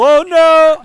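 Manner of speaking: high, loud ad-style cry; accidental commercial announcer; loud, high comic exclamation; Old Spice voice. Shown as a demonstration. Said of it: "sounded like the damn Old Spice commercial".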